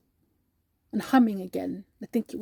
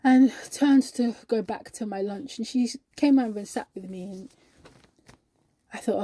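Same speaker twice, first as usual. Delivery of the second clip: usual speaking voice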